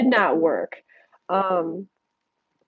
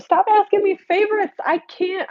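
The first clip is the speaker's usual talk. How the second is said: high-pitched protesting outburst; playful exasperation; 12 semitones higher than usual. Real, not acted. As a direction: plead with them to stop, voice jumping way up. Protests viewers asking for favorites.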